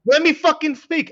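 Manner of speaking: high, loud, drawn-out protest at being cut off; loud, slow, exasperated plea; exasperated insistence. Real, not acted.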